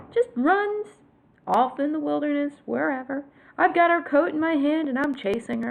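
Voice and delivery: ordinary talk, nothing marked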